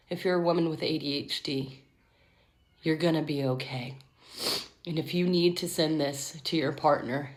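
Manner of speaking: soft, low and sincere; tender reassurance. Real, not acted. Woman with ADHD comforting others like her, tenderly.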